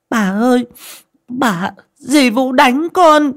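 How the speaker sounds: high, drawn-out tearful cry for help; wailing child. A narrator's reading. Beaten girl runs to grandmother for help, wailing. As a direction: wail to grandma like a hurt little girl. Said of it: "ôm mặt khóc thét lên".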